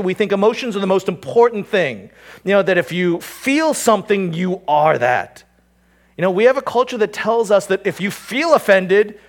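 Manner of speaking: impassioned sermon push; preaching at full force. Acted. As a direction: hammer the culture's error. Preaching build.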